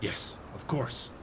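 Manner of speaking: soft, breathy yes; hushed agreement. Acted. Prisoner whispering agreement to the disguised rogue, hushed. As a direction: answer in a hushed voice.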